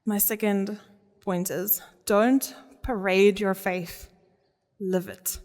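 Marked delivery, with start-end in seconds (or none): none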